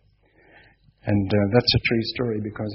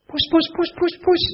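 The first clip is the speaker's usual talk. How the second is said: high sing-song kitty call; calling the cat; 4 dB louder than usual. Retold or imitated. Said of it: "called out".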